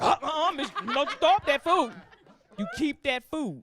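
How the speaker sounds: loud, high telling-off; scolding inner voice. Acted.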